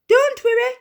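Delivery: high, loud fussing mum; eager exclamation. A narrator's reading. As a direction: rush in to help. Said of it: "exclaimed Davish's mum".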